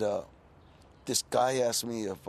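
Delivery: ordinary delivery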